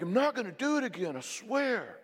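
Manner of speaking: high-pitched protesting husband; mock pleading promise. Husband promising wife he'll stop, imitated pleading.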